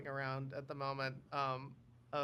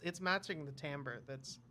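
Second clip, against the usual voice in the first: normal talking voice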